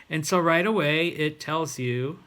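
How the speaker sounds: ordinary talk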